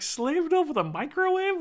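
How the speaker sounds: high-pitched echo of disbelief; incredulous. Acted. Reviewer scoffing at the wife's microwave line, incredulous. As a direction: query it in rising, mock astonishment.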